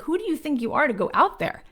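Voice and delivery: high, incredulous retold scolding, sputtering then building; angry mother. Mom to daughter leaving for L A, angry and disbelieving. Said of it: "She was so angry at me".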